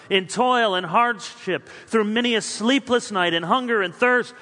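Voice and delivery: ordinary delivery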